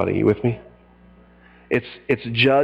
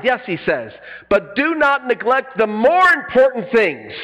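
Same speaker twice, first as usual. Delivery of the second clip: a hint of Jesus scolding the Pharisees, pitched up; Jesus rebuking; 6 semitones higher than usual. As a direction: preach his rebuke, lightly voiced. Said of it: "He says this".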